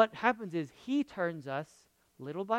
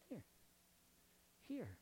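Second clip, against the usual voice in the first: tender offering; 27 dB quieter than usual. Retold or imitated. Jesus tenderly offering hands.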